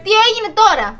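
high, very loud jeer; taunting shout. Real, not acted. Girlfriend rubbing in her kill, high loud taunt. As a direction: shout it in his face. Taunting after killing him.

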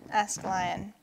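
ordinary delivery

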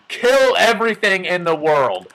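sudden loud hammed-up yell; playful over-the-top shout; mock villainous outburst. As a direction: burst out with cartoonish murderous urge. Hammed-up murderous urge of NPCs.

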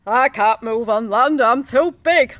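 loud, heavy whale moan. A narrator's reading. Beached whale answers the snail, loud moan. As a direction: moan it out loud as a big stranded creature. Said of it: "moaned the whale".

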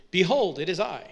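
loud, hammed-up biblical proclamation; mock-grand announcement. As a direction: announce yourself with comic pomposity. Mock archaic greeting at friend's door.

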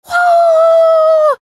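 long, high, loud "woooo"; imitated crowd roar. Playing the crowd going wild, drawn-out whoop. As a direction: mimic a wild stadium cheer. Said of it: "and the crowd goes wild".

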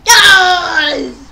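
loud, high burst of joy; triumphant shout; real shout of joy. Real, not acted. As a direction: yell yes at the screen. Celebrates a near goal.

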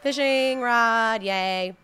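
slow, drawn-out fishing rod; hammed-up excitement over a prize; mock cheer. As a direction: stretch it out in fake delight.

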